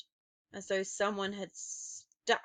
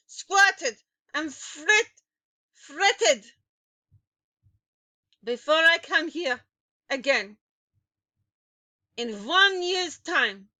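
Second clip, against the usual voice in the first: shrieking witch; 8 semitones higher, 12 dB louder than usual. A narrator's reading. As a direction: shriek your orders down at the crowd. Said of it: "shrieked the Grand High Witch".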